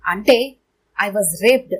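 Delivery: ordinary delivery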